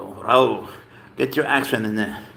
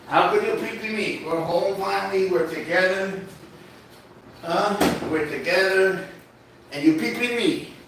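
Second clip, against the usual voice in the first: high pet-talk voice; 7 semitones higher than usual. Acted. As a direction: coo at the cat in a high voice.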